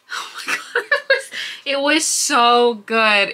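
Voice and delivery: laughing through the words, slowed down. Real, not acted. Vlogger gushing to viewers about the book, laughing. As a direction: giggle out your delight.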